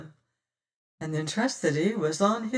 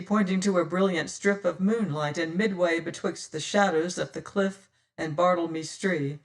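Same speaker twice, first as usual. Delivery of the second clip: ordinary delivery, nothing marked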